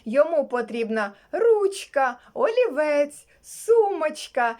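usual speaking voice

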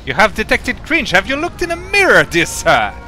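high, loud and fast, indignant; mock indignant. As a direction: huff back like an offended gentleman. Mock indignant comeback to teammate.

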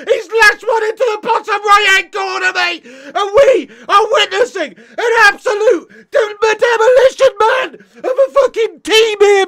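crying out in real despair, loud and high; anguished shout. Real, not acted. Fan screaming at his team's collapse, anguished and loud. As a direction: yell at the screen as the third goal goes in. Fan reacts to conceding third goal.

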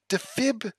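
ordinary delivery

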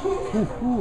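high, thrilled ooh-ooh; a collector who just spotted a gem; excited ooh. Real, not acted. Browsing the radio shop, thrilled at a new find, excited ooh.

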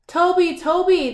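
high, loud quick calls of Toby; excited chatter. Acted. Monkeys chatter Toby's name on his return, excited. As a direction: call his name over and over, delighted. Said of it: "they chattered".

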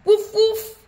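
high, loud yappy puppy barks. Acted. Reader barking as Biscuit the puppy, high and loud.

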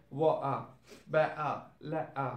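"Water, better, letter" with a throat catch for each T; glottal T. Shown as a demonstration. Teacher drilling Cockney British, "Water, better, letter" with glottal T. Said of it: "glottal T sound".

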